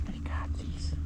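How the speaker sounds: soft whispered aside; whispering. Real, not acted. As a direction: whisper it under breath.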